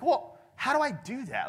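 puzzled listener. Retold or imitated. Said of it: "Because it's like".

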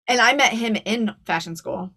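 ordinary talk

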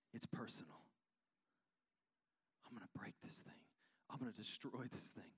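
low, near-whispered vow; hushed resolve. Retold or imitated. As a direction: say it very softly, like a personal vow. Jesus's vow facing death, retold.

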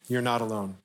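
a hushed, sincere echo of the line; soft reassurance. Real, not acted. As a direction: let it land gently and quietly, like comfort. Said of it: "You're not alone in this".

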